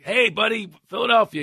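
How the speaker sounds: high-pitched retold hail to a fellow Philadelphian; calling out. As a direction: hail a stranger from home. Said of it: "recognize the accent".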